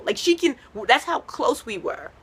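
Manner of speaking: ordinary delivery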